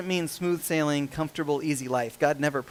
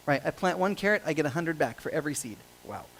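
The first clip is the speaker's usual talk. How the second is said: ordinary talk, nothing marked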